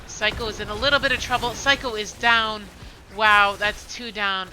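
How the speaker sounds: loud, rising hype call; excited play-by-play. Real, not acted. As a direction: rush through the fight call.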